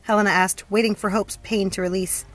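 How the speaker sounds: ordinary delivery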